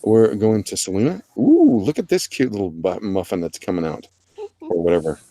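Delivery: usual speaking voice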